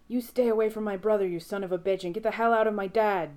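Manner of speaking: furious shout at the demon. A narrator's reading. Dean shouting at the demon in his father. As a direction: scream it to save your family. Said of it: "Dean is shouting".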